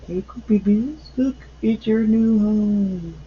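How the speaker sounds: light sing-song show-and-tell; playful cooing. Pretend. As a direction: coo to little ones, stretching the last words. Addresses Coopy Bees about new home.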